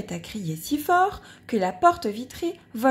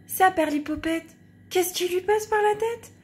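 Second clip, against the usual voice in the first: puzzled neighbour; 8 semitones higher than usual. Acted. Impresario wonders at trumpet.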